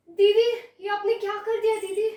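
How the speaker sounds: high, urgent cry to an elder sister; crying out in alarm. Acted. Younger sister demanding what was done, alarmed cry. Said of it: "जोर से चिल्लाने लग गई".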